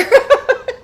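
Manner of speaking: high, loud burst of laughter. Real, not acted. Laughs at the story.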